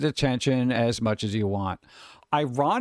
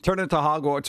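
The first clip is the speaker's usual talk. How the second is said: ordinary talk, nothing marked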